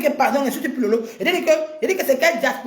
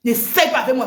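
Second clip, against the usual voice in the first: normal talking voice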